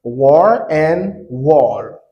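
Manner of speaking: War and wall drawn out slowly, the R against the L; teacher slowly contrasting War with wall. Shown as a demonstration. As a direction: say War and wall slowly so the two endings differ.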